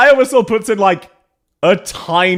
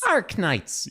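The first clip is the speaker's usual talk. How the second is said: higher copy of her American "Arknights"; imitated non-Aussie accent; 5 semitones higher, 4 dB quieter than usual. Streamer echoing her Arknights, higher imitated American accent. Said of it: "let's listen to her Arknights".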